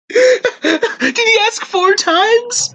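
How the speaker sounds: squeaky laughing disbelief; asked through high giggles. Real, not acted.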